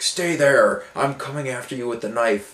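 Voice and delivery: lightly voiced menacing stalker; a hint of a threatening maniac; menacing killer voice. Retelling a limping attacker scene.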